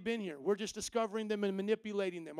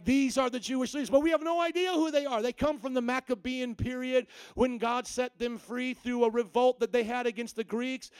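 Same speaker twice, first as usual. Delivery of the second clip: ordinary delivery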